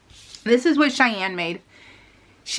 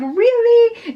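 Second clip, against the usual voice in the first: surprised really; 13 semitones higher, 6 dB louder than usual. Retold or imitated. Cheyenne surprised they dislike it.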